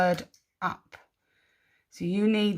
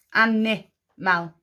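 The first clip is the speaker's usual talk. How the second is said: "Animal" slowly, with a gap before the last syllable; "Animal" in separate measured parts; slower than usual. Shown as a demonstration. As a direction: sound out "Animal" for spelling. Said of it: "Slow it down".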